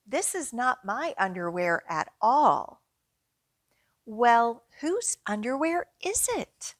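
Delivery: lively picture-book read-aloud; reading to children. Acted. Librarian reading a picture book to children at story time. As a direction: read it out brightly for little kids.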